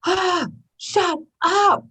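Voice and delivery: drawn-out, high, fed-up brush-off; a slow, high "ah, shut up" to your own mind; exasperated shut up. Retold or imitated. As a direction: wave off the nagging voice in your head. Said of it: "I'm just like".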